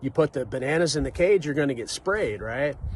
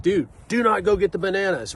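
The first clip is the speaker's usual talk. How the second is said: louder, higher mock scolding; annoyed monkey warning; 6 semitones higher, 6 dB louder than usual. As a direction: bark the warning like a fed-up old monkey. Angry monkeys warning newcomer.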